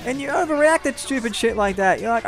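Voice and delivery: normal talking voice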